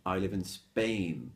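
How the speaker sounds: SPAIN as one syllable straight from S; slow model line, "in Spain" with no "eh"; clean initial S. Shown as a demonstration. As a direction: slowly say SPAIN without any vowel before the S. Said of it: "So you have to say".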